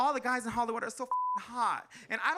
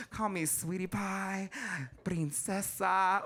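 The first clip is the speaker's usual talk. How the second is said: ordinary delivery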